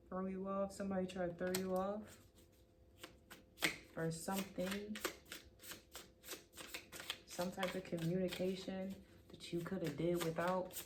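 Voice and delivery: ordinary talk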